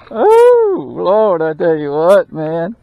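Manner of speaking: normal talking voice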